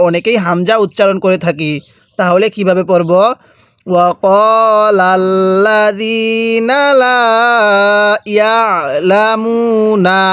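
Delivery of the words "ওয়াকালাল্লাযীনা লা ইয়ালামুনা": ওয়াকালাল্লাযীনা লা drawn out, long held vowels; light throat pressure on the ain of ইয়ালামুনা; measured tajweed model of ওয়াকালাল্লাযীনা লা ইয়ালামুনা; slow joined recitation. Shown as a demonstration. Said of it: "এরকমভাবে শিখব".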